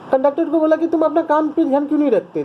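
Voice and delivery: कहानी की लड़की की हल्की झुंझलाहट; annoyed retort. Retold or imitated. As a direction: voice the girl's pique lightly. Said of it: "इस पे और गुस्सा आ गया".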